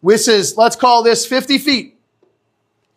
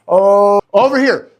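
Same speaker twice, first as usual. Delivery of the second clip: usual speaking voice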